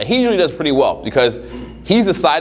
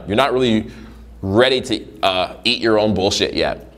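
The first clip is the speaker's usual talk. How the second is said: ordinary talk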